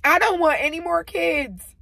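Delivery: high, loud mock of mom. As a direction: play the mother, hammed up. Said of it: "She's married to my dad".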